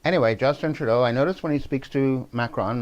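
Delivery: normal talking voice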